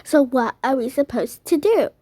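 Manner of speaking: normal talking voice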